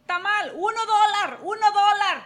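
loud, high-pitched hawker's cry; street vendor's call. Retold or imitated. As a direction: call out your wares to passing customers. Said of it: "how to sell my own tamales".

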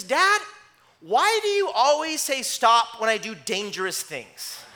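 protesting daughter. Retold or imitated. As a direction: complain at Dad like an exasperated child. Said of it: "And she says".

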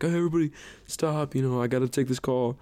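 lightly voiced teacher halting the power tools; imitating teacher calling out. Teacher calls to the noisy class over power tools, mild mock call-out.

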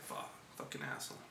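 very low, voiceless grumble; muttered curse. Retold or imitated. Father-in-law sees him arrive, muttered curse. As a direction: curse under your breath.

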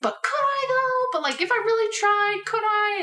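very high, hopeful pleading; pleading question. Retold or imitated. Asking roommate about fooling someone, high pleading. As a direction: push the voice up high as if wheedling.

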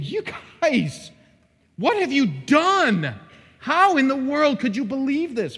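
high, loud, outraged rebuke; you can't believe what they've done, let it rise; livid. Acted. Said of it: "He is livid".

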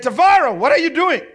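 high, loud voice of God calling; the Lord calling loudly. Retold or imitated. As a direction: call him out like God questioning. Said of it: "He said".

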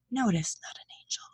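soft unvoiced whisper; whispered aside. Real, not acted. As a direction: drop to a whisper. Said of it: "sound-wise, that's not gonna pick up".

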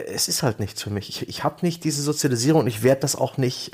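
ordinary talk, nothing marked

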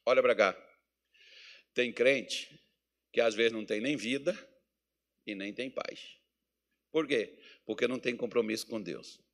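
usual speaking voice

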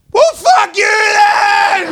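high, loud, slow indignant yell; defiant shout. Retold or imitated. Drunk girl turned away by the bouncer, hammed-up defiant shout. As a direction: fling it back with wounded dignity. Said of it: "straightened themselves up and went".

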